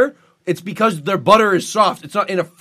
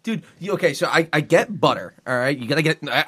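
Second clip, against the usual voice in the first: ordinary delivery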